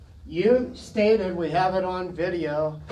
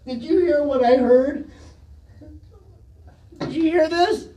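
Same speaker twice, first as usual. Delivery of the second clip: louder and higher, rising challenge; indignant shout; 7 semitones higher, 6 dB louder than usual. Real, not acted. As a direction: demand an answer from the room. Neighbour outraged at official.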